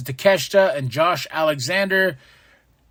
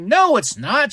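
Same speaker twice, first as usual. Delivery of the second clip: higher, indignant no; playful indignant denial; mock denial; 8 semitones higher, slower than usual. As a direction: protest it like a kid caught out. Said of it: "I'm like".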